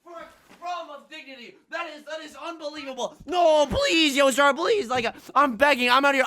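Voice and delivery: desperate begging. Real, not acted. Begging the game for one tile, hammed-up high shouting. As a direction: beg like a starving man. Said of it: "I have to beg like this".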